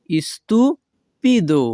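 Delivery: slow stupido, pause after "istu"; syllable by syllable. Shown as a demonstration. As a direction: break stupido in two for learners. Teacher repeats word split for learners.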